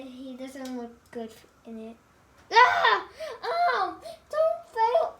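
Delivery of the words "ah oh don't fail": startled cry. Real, not acted. A toy is falling.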